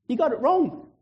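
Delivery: high-pitched, incredulous protest; high mock objection; acted disbelief. Zechariah's doubt, then preacher's sober echo.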